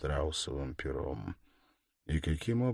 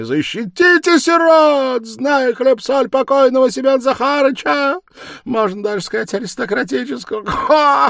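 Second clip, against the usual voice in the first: much higher and louder, tearful wailing; heart-rending wail; 17 dB louder than usual. Acted. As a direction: howl it out, sobbing for the orphans. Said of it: "завопила она раздирающим воплем и залившись слезами".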